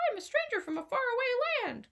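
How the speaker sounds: high put-on stranger voice; disguised stranger. A narrator's reading. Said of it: "he says".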